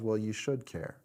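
low, soft, sober answer; calm reply. Acted. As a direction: answer the outburst quietly, dropping the pitch. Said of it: "Jim then replies".

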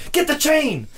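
high-pitched mock yell, no real volume; imitated yell. Quoting a yell heard during the match, high mock yell. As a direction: push the pitch way up like someone yelling. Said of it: "the cat yelling".

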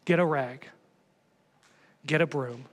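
ordinary talk, nothing marked

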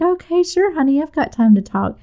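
high, forced breezy tone; a mom faking calm, sweetly high; fake casual. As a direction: sound relaxed while panicking inside. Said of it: "I'm trying to play it cool".

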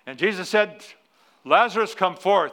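loud, high, booming call; mock grand sermon cry; dramatic proclamation. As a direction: proclaim it like a young preacher. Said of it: "I had a dramatic pause in my sermon".

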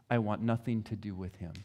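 soft, quiet final refusal; gentle quote. Retold or imitated. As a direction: close it gently, almost a murmur. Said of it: "they will very gently say".

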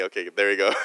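usual speaking voice

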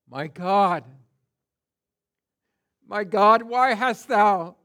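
loud pleading cry, slowing on why; loud anguished cry. Acted. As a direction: call out to God in anguish. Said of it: "he cries out".

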